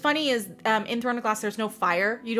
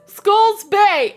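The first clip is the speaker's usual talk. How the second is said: louder and higher punchline reveal; triumphant reveal; 11 semitones higher, 9 dB louder than usual. Retold or imitated. Chaol answering his own riddle, loud mock triumph. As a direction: land it like a mic drop. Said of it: "And he says".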